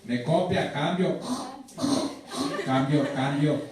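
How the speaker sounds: radio call, over and out; loud radio chatter, calling base; pilot cockpit headset. Acted.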